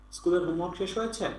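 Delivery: ordinary delivery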